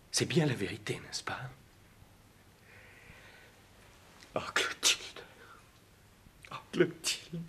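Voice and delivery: broken, breathy, barely voiced; stricken whisper. Betrayed lover realizing Clotilde's confession is true, whispering. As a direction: let the words collapse into a whisper.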